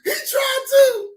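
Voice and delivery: high, loud, laughing shout. Real, not acted. Telling Lance, laughing high and loud.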